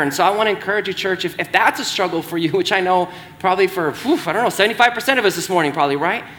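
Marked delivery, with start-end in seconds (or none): none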